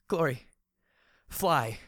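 high, loud urgent shout. A narrator's reading. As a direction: yell it to make her fly. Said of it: "I shouted".

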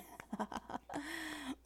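high, quiet giggle; light giggle under the breath; soft giggle. Acted. Amused with a partner, soft high giggle.

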